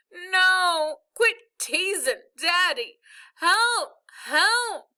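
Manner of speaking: high, loud, pleading protest; distressed wail. A narrator's reading. Stuck boy begging father to quit teasing, distressed wail. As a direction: wail helplessly for it to stop.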